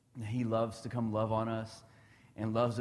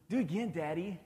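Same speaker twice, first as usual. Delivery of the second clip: lightly voiced small boy begging, higher; a hint of a breathless kid asking for more; eager little boy; 7 semitones higher than usual. Dad imitating his son begging for more tickles, lightly childlike. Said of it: "But then they get their breather".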